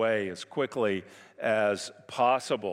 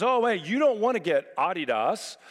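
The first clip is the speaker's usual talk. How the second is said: pushy stranger; 7 semitones higher, 4 dB louder than usual. Retold or imitated. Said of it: "he says".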